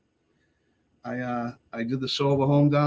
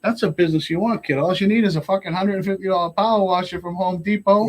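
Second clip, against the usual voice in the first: raised, louder friendly urging; emphatic pitch; 7 semitones higher, 5 dB louder than usual. Retold or imitated. Friend urges him to start power washing, emphatic. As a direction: sell the idea hard to a youngster.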